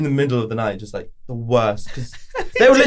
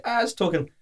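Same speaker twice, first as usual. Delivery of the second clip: startled; 7 semitones higher, 6 dB quieter than usual. Retold or imitated. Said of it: "and be like".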